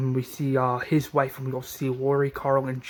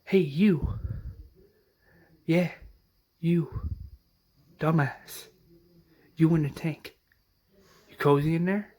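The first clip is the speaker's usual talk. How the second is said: mock radio voice; 3 semitones higher than usual. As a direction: call out teasingly like a voice over the radio. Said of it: "and the voice says".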